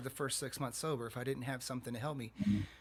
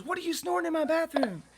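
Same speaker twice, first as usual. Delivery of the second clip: high, loud scolding woman; shrill-free high scold, catching him out; high-pitched woman voice; 6 dB louder than usual. Retold or imitated.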